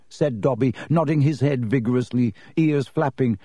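ordinary delivery, nothing marked

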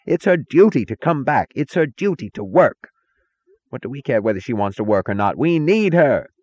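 high-pitched, insistent shout; screaming. A narrator's reading. Taggart insisting they need her back, screaming. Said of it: "screamed Taggart".

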